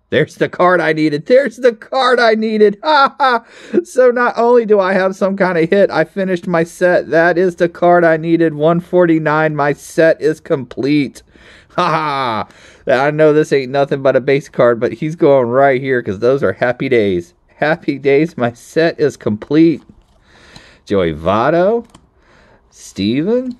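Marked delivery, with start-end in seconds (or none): 0.1-11.2 high-pitched, louder triumphant cries; real elation. Real, not acted. Card collector finds the last card for the set, elated. 11.7-12.5 high, gleeful "ha ha"; triumphant laugh. Real, not acted. Collector celebrating a completed card set, triumphant laugh. 12.9-19.8 elated, raised pitch; real delight. Real, not acted. Collector showing off the card that completes the set, delighted.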